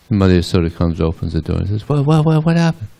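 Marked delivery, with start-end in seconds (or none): 1.9-2.9 high, startled questioning; acted alarm. Mother Yasoda answering the door, flustered. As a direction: repeat it in high-pitched mock bewilderment.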